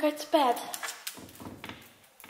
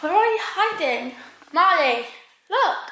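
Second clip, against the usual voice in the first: loud high call across the yard; 7 semitones higher, 8 dB louder than usual. Real, not acted. Girl calling her lost dog, loud high call. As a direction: shout the dog's name.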